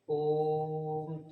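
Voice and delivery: ओम said with the vowel held long, pluta style; drawn-out Om. Shown as a demonstration. Teacher demonstrating a prolonged vowel, ओम drawn out. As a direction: stretch the ओम well past two beats. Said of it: "जैसे मान लीजिए कि आप ओम कह रहे हैं".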